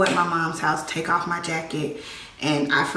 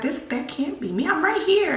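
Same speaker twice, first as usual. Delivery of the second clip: higher, disbelieving cry; stunned, higher protest; shocked disbelief; 10 semitones higher than usual. Retold or imitated. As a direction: protest that it can't be her. Sees herself in casket.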